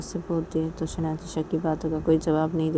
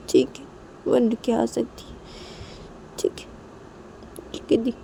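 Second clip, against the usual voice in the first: crying sister; 4 semitones higher than usual. Acted. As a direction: cry it out, voice pitched up through tears. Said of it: "अब ऐसे रो मत ना". (रोते हुए ऊँची आवाज़ में शिकायत)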